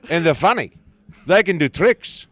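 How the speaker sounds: loud, high South African accent; a jovial Johannesburg local talking horses. Shown as a demonstration. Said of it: "I can do a South African accent".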